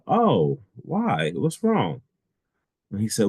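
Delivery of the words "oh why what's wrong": higher-pitched, concerned questioning; concerned surprise. Retold or imitated. Asking an upset young man what happened, concerned. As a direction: react with lifted, puzzled concern.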